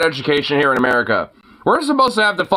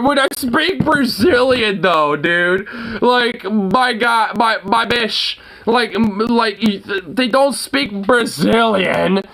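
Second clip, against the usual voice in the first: normal talking voice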